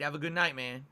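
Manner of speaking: ordinary delivery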